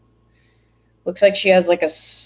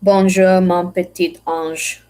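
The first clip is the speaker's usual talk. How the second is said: mock French accent, a bit louder on Bonjour; French phrase attempt; 2 semitones higher, 4 dB louder than usual. Shown as a demonstration. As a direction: try the French like a pro. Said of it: "hear me speak French like a pro".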